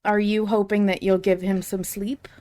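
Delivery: usual speaking voice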